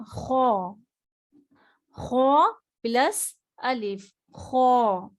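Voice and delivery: خو said full and rounded; a thick throaty kho; heavy kho. Shown as a demonstration. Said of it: "اب دیکھیے یہاں پہ کیا ہے". (خو موٹا پڑھیں)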